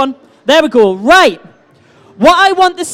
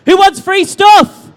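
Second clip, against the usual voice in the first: loud, higher shout to the crowd, stretched out slowly; crowd call-out; 5 semitones higher, 5 dB louder, slower than usual. Real, not acted. Host asks crowd who wants free stuff, calling out loudly. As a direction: hype them up with a raised, drawn-out question.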